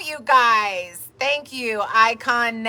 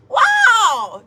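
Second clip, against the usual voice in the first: much higher, amazed outburst; surprised wow; 10 semitones higher, 4 dB louder than usual. Real, not acted. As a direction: react like the news floored you. Reacting to a viewer's news.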